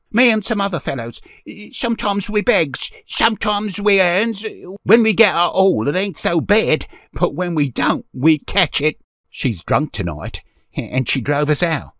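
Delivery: put-on high, loud street-boy voice; child explaining, easing down in pitch toward the end; boy gaining courage. A narrator's reading. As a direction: tell it like a plucky urchin, high and loud. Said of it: "gave him courage".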